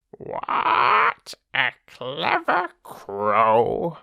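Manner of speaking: slow, drawn-out crowing self-praise. Acted. Crow congratulating itself at the jug, slow proud croak.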